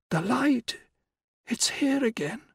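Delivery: high, bright cry of wonder; sudden awed outburst. A narrator's reading. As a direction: break out in awe. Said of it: "she broke out".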